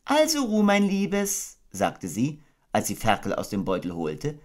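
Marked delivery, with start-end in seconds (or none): 0.0-1.6 high, gentle mother kangaroo voice; motherly Kanga. Acted. Kanga tucking her baby into bed, motherly. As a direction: coo it like a doting mother.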